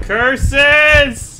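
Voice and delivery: hammed-up loud villain curse; mock villain cry. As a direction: shake your fist like a cartoon villain. Mock villain exclamation on dying.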